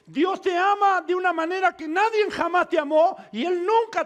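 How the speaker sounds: loud, high-pitched impassioned proclamation; fervent pulpit shouting; preaching at full force. Real, not acted. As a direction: preach it out to the whole congregation.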